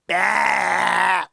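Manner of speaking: one loud, drawn-out "bah"; imitated loud wheeze. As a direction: mimic her noise in the background, loudly. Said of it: "Pretend you don't hear her go".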